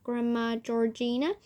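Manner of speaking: plain reading voice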